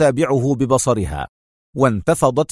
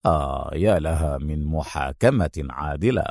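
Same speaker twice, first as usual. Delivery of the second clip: much lower, softer mock surprise; dry sarcasm at fake justice; sarcastic; 8 semitones lower, 5 dB quieter than usual. A narrator's reading. Adham mocks the trial, low sarcastic aside. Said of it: "وهو يقول في سخرية".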